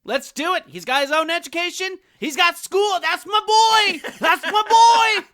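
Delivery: rising to a high, loud, proud cheer; hammed-up proud father, building to a shout; giddy proud dad. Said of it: "So I was like".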